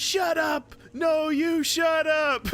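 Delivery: high, drawn-out bickering yell; screaming cartoon goats. Recreating the Brother Bear goats for a friend, shouted. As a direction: holler it like a squabbling cartoon goat. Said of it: "scream to all day".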